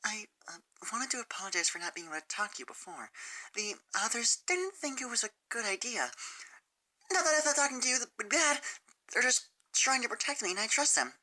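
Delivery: nervous, picking each word; hesitant Sunset. Acted.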